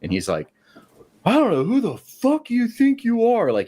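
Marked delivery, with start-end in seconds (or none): 1.2-3.5 much higher, furious outburst; angry shout. Retold or imitated. Manager yelling at employee on the production floor, angry shout. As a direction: blow up at him in front of everyone.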